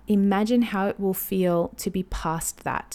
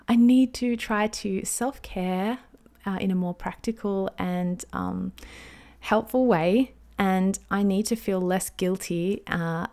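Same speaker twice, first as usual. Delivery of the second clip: ordinary talk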